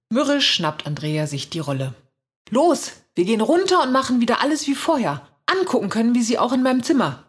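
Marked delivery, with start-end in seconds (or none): 2.5-7.3 hohes, energisches Kommando; befehlend. A narrator's reading. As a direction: die Schwester bestimmt zum Gehen antreiben. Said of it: "befiehlt sie". (energisch befohlen, mit gehobener Stimme)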